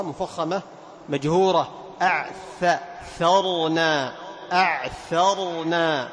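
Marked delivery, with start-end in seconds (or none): 2.0-6.1 very slow أعثرنا with a long breathy ث before ر; teacher modelling أعثرنا; slow clear tha. Shown as a demonstration. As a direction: drag out أعثرنا, ث hissed clearly. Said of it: "هكذا".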